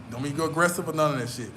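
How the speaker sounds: normal talking voice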